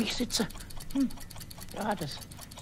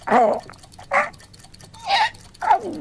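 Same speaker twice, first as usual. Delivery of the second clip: pained ouch; 4 dB louder than usual. Acted. Pumuckl hurts himself opening watch.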